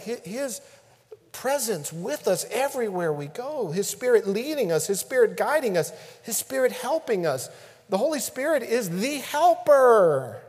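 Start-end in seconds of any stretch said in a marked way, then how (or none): none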